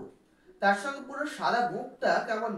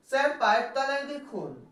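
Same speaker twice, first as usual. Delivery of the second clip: raised, high-pitched warning; alarmed cry; 6 semitones higher than usual. Acted. Four pupils warn the teacher of the snake, alarmed cry. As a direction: cry out a warning without a full shout. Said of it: "চারজন চিৎকার করে ওঠে".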